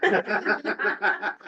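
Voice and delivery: drawn-out word, laughing through it. Real, not acted. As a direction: let the laugh carry the word.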